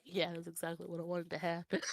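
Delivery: low, very soft aside; mock quiet satisfaction. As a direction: play a reader quietly admitting satisfaction. Acted satisfied reader after the payoff.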